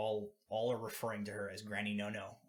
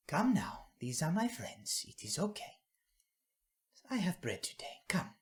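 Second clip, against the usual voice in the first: put-on higher, kindly old granny calling children; 8 semitones higher, 4 dB louder than usual. Granny coaxing shy children closer, higher and kindly. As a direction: coax the wary kids like a gentle grandmother.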